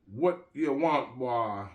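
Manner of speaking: slow, loud, high Jamaican challenge; Jamaican accent. Acted. Jamaican dealer challenges Gangsta Jake at the gate, slow loud Jamaican accent. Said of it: "asked in a deep Jamaican accent".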